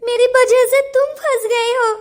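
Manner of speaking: tearful, choked blame of herself; crying. Acted. Girl blaming herself to the boy, crying. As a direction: let the tears break the words.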